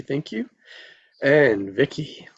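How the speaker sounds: normal talking voice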